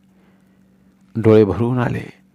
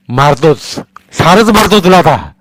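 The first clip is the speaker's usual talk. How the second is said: furious threat; 7 semitones higher, 8 dB louder than usual. Acted. Father threatens son.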